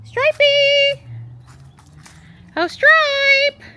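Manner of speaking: loud, high, drawn-out holler; calling out for pet. Real, not acted. Owner calls Stripey to come running, high loud holler. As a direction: call a pet from across the yard, high and long.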